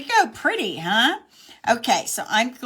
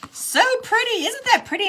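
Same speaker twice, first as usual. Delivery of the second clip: much higher, gushing over pretty; bright delight; delighted admiration; 14 semitones higher, 4 dB louder than usual. Real, not acted. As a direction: coo over the card. Admiring finished card.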